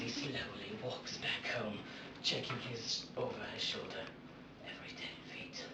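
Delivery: soft, fading almost to whisper; fading hush. Acted. Batman watching the janitor man walk home, fading hush. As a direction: let it trail down into a hush. Quiet ending of the story.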